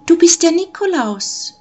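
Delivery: put-on high, loud child voice; excited children's shout. A narrator's reading. Children recognise Nikolaus, shouting high and excited. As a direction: cry it out like a thrilled child. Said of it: "schrien aufgeregte Kinderstimmen durcheinander".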